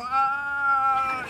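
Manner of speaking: high strained groan with no breath; hammed-up breathless moan; winded groan. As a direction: groan like the wind was knocked out. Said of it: "And I go".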